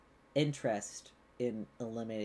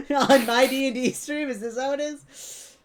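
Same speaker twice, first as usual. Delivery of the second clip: high laughing tease, much louder; 13 semitones higher, 12 dB louder than usual. Real, not acted. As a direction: laugh through the words, delighted. Teasing friend drinking on stream.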